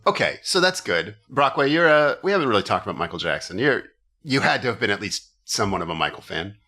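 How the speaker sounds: ordinary delivery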